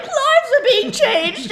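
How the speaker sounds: very high, slow, loud exclamation; mock fervor. Missionary defending cookie-making, over-the-top fervor. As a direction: proclaim it ecstatically, hammed up. Contrasting herself with disapproving elders.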